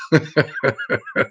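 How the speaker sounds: short, soft chuckle; real, quiet giggle to oneself; laugh. Real, not acted. Asking viewers about library loans in Australia, soft laugh.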